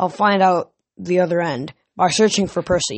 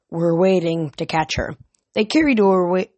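plain reading voice

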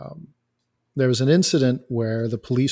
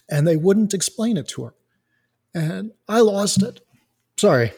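real choked-up feeling, higher pitch; getting emotional; 7 semitones higher than usual. Real, not acted. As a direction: voice lifting as emotion wells up. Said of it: "I'm getting a little emotional".

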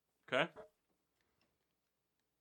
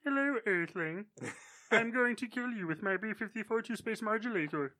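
light nasal alien voice; small prim Martian voice; Marvin the Martian impression; 6 dB quieter than usual. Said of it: "Do the Marvin".

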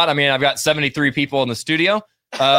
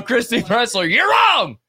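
playful call-out; 8 semitones higher, slower than usual. Acted.

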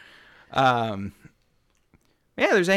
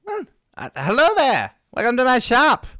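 high, chirpy little gnome merchant; gnome shopkeeper voice; 9 semitones higher, 5 dB louder than usual. Acted. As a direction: greet the customers as an eager little gnome. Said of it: "he, uh, says".

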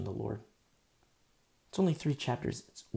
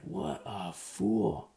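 slow, drawn-out scorn; scornful thought; much slower than usual. Retold or imitated. Preacher recalling his thought about the elder, slow and scornful. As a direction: let the contempt drag out. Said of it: "I'm like".

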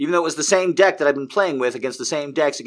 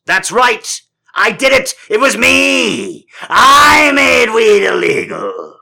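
high, loud shout, building to drawn-out words; hammed-up yell, stretching the ends; triumphant confession; 11 semitones higher, 9 dB louder, slower than usual. Comic confessing to making weed illegal, triumphant screaming boast.

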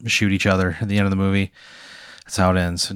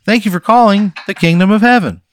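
high, loud pitchman on a recording; recorded phone greeting; 9 semitones higher, 5 dB louder than usual. Acted. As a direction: read it like a canned phone greeting. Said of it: "and he says".

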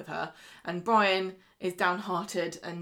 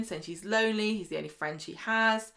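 ordinary talk, nothing marked

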